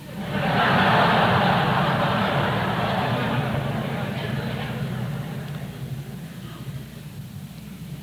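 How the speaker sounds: high breathless laughter rolling on; real helpless laughter at the punchline; long real laugh. Real, not acted. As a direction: let the laugh run out on its own. Laughing after the key joke.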